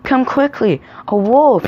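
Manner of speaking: high, urgent storybook shout; shouted alarm. A narrator's reading. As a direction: raise the alarm, high and loud. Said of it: "he shouted".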